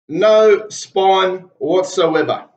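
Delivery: very slow, each word hammered; slow emphatic. Real, not acted. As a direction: drag it out with contempt, word by word. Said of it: "Let that sink in".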